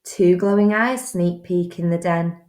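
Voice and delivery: usual speaking voice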